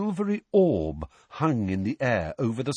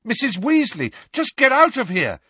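shout; 6 dB louder than usual. A narrator's reading. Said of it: "shouted Harry".

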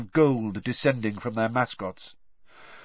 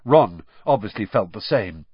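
ordinary delivery, nothing marked

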